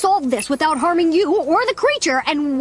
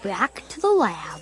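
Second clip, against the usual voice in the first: quiet; 8 semitones lower, 7 dB quieter, slower than usual. Acted. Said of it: "Wait, do it again".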